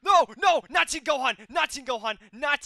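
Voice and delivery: high, loud pleading that fades; panicked pleading. Real, not acted. Gamer begging the opponent, panicked loud pleading. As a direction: beg loudly, then ease off.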